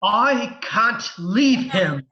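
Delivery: slow, swooping emotional protest; anguished droid. Acted. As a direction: refuse to abandon a fallen friend. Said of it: "emotion like we've never seen from him".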